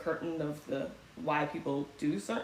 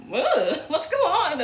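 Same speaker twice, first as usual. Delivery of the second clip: mock confusion; 9 semitones higher, 6 dB louder than usual. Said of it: "we're out here just like".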